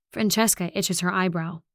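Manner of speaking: ordinary delivery, nothing marked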